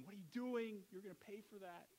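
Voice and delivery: indignant high yell at the roof-breakers; imagined angry shout. Retold or imitated. House owner yelling up at men cutting his roof, indignant shout. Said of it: "maybe starts shouting at them".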